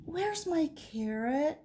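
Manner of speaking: lightly voiced rabbit, high pitch; questioning rabbit. A narrator's reading. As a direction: ask high as a story-time rabbit. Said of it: "Rabbit asked".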